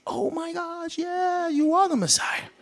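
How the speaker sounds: high, breathless amazement; high hushed mock astonishment; awed gasp. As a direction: gasp it out in wonder. Nathaniel's sudden awed recognition.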